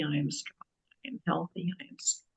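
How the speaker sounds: very soft, fading whisper; hushed self-affirmation, barely voiced. Shown as a demonstration. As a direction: murmur it under your breath, as if to yourself. Murmured demo of repeated affirmations.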